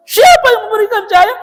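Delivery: high, loud question with a crying edge; emotional loud question. Retold or imitated. Trainer pressing the crowd, loud and tearful. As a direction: push the question, voice near tears.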